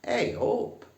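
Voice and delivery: high, loud Northern "Hey up"; broad Yorkshire "Hey up". Shown as a demonstration. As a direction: greet the room broad Northern.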